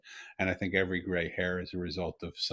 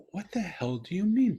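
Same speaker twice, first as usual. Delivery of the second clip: high-pitched disbelieving question; retold astonishment; 10 semitones higher than usual. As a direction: replay being utterly taken aback, voice leaping up. Retells his astonished reply to daughter.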